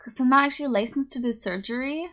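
usual speaking voice

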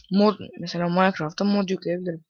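normal talking voice

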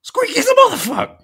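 loud, extremely high mock shriek; imitated yelling. Shopper yelling at a stranger about her cart, mimicked high yell. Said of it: "but just yelling at a stranger".

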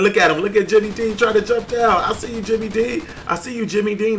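loud, high frantic callout; excited alarm. Real, not acted. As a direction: holler at a rival closing in. Rival closing in on his kill.

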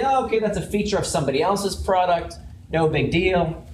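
ordinary talk, nothing marked

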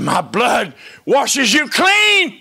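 slow, loud proclamation; triumphant shout. Acted. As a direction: ring it out over the church. Jesus voiced, congregation answers.

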